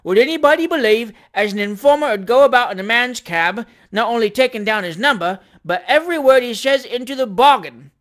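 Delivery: appealing to crowd. A narrator's reading. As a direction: rouse the onlookers with your grievance. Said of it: "appealing to the crowd".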